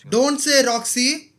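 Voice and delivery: loud, slow cry of protest; alarmed shout. Real, not acted. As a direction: yell at him not to say it. Shouting at character on screen.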